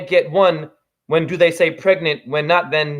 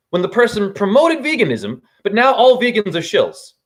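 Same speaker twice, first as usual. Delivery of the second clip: normal talking voice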